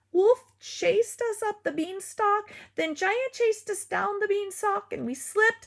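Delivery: high teary sobbing. A narrator's reading. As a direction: tell it through tears. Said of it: "Spoon sobbed".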